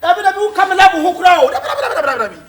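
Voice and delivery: fast, loud, high-pitched "dabi, dabi" repeated in protest. Real, not acted. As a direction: shout down the sister, refusing with a laugh. Heated denial to sister.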